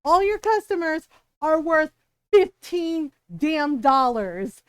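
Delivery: loud, slow indignant yell; imitating a furious customer, slow and emphatic. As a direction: hammer each word out in outrage. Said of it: "that's when Ron yelled".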